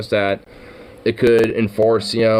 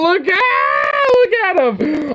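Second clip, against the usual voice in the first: high, laughing disbelief; excited laughing shriek; 3 dB louder, much slower than usual. Real, not acted. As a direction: squeal it out in shocked delight. Laughing shock at the show.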